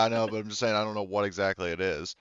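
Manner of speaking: ordinary talk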